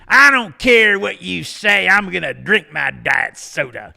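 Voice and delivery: slow, loud mocking voice with a high start; defiant lady. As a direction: drag it out like a defiant stranger. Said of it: "And she says".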